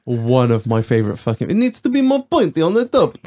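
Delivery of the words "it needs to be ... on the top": higher, lightly put-on foreign accent; mock Dictator accent. Quoting The Dictator to friends, mock accent. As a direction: quote the dictator in his accent.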